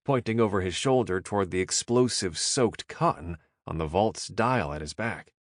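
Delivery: ordinary delivery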